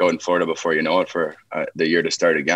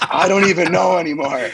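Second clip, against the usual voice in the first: laughing quote; 9 semitones higher, 4 dB louder than usual. Retold or imitated. Retold reply to angry coach.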